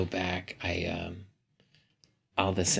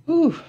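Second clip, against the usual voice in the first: a high, loud burst of breath; sharp loud exhale; 12 dB louder than usual. Real, not acted. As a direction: blow it out hard like shaking off nausea. Steadying herself after nausea.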